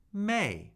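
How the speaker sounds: "may" falling from high; fourth tone. Shown as a demonstration. Teacher cycling the tones with a syllable, "may" falling. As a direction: start "may" high and drop it quickly. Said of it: "and the fourth tone".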